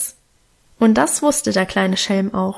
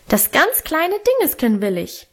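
put-on high, demanding little boy voice; insistent toddler; 6 semitones higher than usual. A narrator's reading. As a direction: push it like a stubborn small child. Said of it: "drängte Hermann noch lauter".

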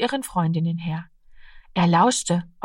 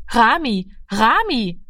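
calling after; 4 semitones higher, slower than usual. A narrator's reading. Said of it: "rief sie ihm nach".

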